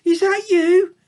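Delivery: put-on high, loud young piglet. A narrator's reading. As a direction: squeak it out as the little piglet. Piglet calls to Ma.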